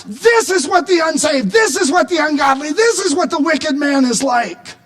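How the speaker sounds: high, urgent outcry to God; crying out. Acted. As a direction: cry out in protest to God. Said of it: "David cries out and says".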